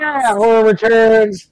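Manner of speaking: drawn-out dramatic title read; slow announcer voice. Pretend. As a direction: stretch the title out like a trailer.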